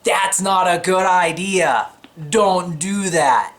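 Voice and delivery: a hint of a stern divine voice saying no; God's warning. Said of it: "God will tell you".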